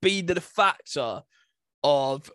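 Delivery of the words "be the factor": ordinary talk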